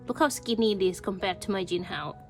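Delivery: ordinary delivery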